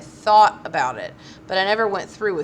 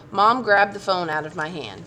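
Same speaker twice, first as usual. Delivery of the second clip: ordinary delivery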